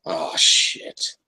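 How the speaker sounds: ordinary talk, nothing marked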